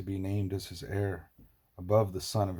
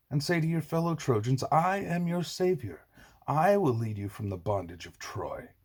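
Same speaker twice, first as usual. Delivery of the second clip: angry tirade; 5 semitones higher, 4 dB louder than usual. A narrator's reading. Assaracus slams cup, berates Brutus.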